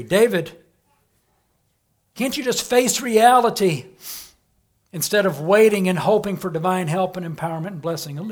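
loud, high, pushing voice; the tempter loudly pressing David; tempting voice. Acted. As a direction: needle him to give up hope. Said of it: "he says, look in verse three".

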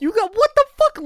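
very high, loud protest; squawked objection at full volume; indignant shout. Real, not acted. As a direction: burst out in outraged disbelief. Bickering over not being invited.

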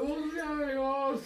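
very high, slow, drawn-out question; high put-on voice of a startled character; bewildered. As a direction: draw it out in mock alarm. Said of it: "He's like".

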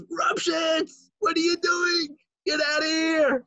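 very high shouted rebuke; shouting rebbe. Retold or imitated. Rebbe Elimelech catching Rupshitz under the bed, very high shout. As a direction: shout him out of the room. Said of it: "rips up the bed and goes".

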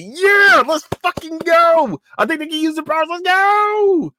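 very high, loud fan yell; hyped cheering. Retold or imitated. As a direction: scream it like your team just arrived. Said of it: "I was hyped to see them".

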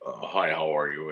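low, slow imitation of a dark voice. Stranger at the blackjack machines greets him in a low, slow dark voice. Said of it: "in this dark voice".

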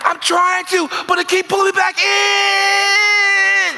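high, loud, slow wail as if crying; desperate shout. Retold or imitated. Pleading with his pastor, wailing high and loud. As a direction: cry out that he can't escape. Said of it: "I'm like".

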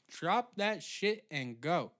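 each word spaced out and punched; slow, emphatic order. Pretend. Comic ranting at delivery drivers, slow emphatic order.

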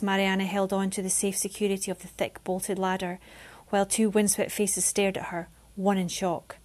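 usual speaking voice

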